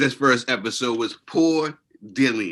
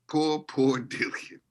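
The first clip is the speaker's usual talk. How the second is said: slow, higher, laughing Poor, poor Dillian; mock pity; 4 semitones higher, slower than usual. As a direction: stretch Poor, poor Dillian out in fake sympathy, laughing through it.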